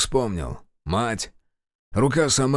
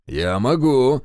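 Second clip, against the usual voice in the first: eager, louder offer; fired up; 3 semitones higher, 5 dB louder, slower than usual. Acted. Reshetov eagerly volunteering to do the thrashing himself, louder. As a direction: jump at the chance. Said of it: "загорелся Решетов".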